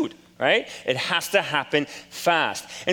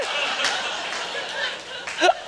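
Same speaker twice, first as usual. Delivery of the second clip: a burst of high, airy laughter; high breathy laugh; 5 dB quieter than usual. Real, not acted. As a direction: laugh it off, tickled by the mistake. Laughing at sibling mix-up.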